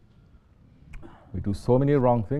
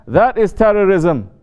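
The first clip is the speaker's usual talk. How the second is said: slow, louder and higher, hammering it home; louder repeat; 7 semitones higher, 7 dB louder, slower than usual. Shown as a demonstration. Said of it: "You understand?".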